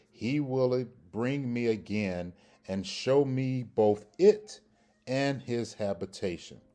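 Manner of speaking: formal, declaiming read-aloud of scripture. Shown as a demonstration. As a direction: proclaim the verse with emphatic pauses.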